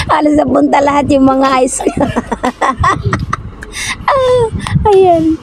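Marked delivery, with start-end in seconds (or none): 1.9-4.0 trying not to laugh. Real, not acted. Stifled laugh during challenge.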